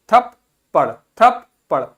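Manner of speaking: "थप्पड़" broken into थप, pause, पड़; split double consonant. Shown as a demonstration. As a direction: sound both प's in "थप्पड़" apart, loudly starting on थप. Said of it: "थप में आधा पा बोला, फिर पड़ बोला".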